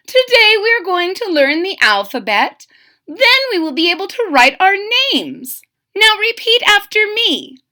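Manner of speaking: high, loud prim teacher; a proper bird schoolteacher announcing; Miss Ibis voice. Acted. Miss Ibis tells class to repeat, high prim teacher.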